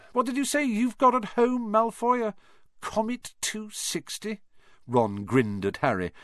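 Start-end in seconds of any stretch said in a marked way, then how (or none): 0.1-4.4 high mocking schoolboy voice; taunting. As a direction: tease him in a high voice. Ron taunting Malfoy.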